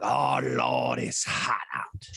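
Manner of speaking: low, slow, drawn-out Southern gentleman voice; old southern general. Retold or imitated. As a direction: fan yourself and drawl it like an old Southern general. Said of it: "talking like an old Southern general".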